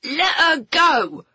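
high, slow yelled cry; shouting. Acted. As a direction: yell it at him, high and drawn out. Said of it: "he shouted".

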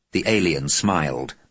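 ordinary delivery, nothing marked

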